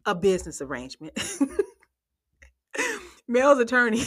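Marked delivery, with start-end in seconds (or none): 1.4-4.1 words through a laugh; giggling while talking. Real, not acted.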